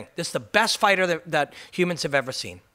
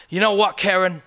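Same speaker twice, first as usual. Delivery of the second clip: higher, louder mock English pundit; bad English accent; 4 semitones higher, 6 dB louder than usual. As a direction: fake an English accent as a TV fight analyst. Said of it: "my English accent is disgusting".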